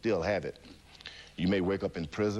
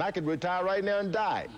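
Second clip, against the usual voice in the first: frantic peak; 11 semitones higher than usual. Retold or imitated. Fighter at the peak of arguing with death. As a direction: top of the panic, thrown out high. Climax of plea.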